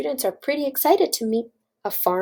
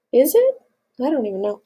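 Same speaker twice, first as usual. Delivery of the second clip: ordinary delivery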